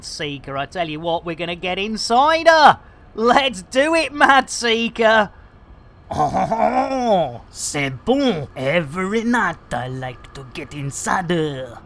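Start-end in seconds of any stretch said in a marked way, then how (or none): 6.1-11.8 lightly put-on Welsh voice, lower; a hint of a Welsh ad voice; Welsh accent. Streamer mimics a cider advert in a light Welsh accent. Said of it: "you gotta love that Welsh accent".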